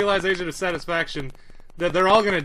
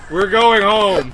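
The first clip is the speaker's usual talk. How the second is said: loud declaration; 3 semitones higher, 9 dB louder, slower than usual. Real, not acted. Declaring the escape.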